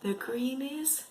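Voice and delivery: ordinary talk, nothing marked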